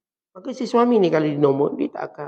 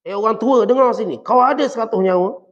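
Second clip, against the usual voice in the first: raised, louder voice at a parent; mock rude snapping; 5 semitones higher, 5 dB louder than usual. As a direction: scold the parent harshly, as a bad example. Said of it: "Jangan tengking dia".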